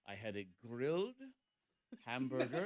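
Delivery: a light hint of a weird accent on "I had a grilled hamburger"; mock her odd accent, lightly. Host mimicking Monica's diet excuse, lightly imitated odd accent. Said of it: "she said it that way with, like, a weird accent".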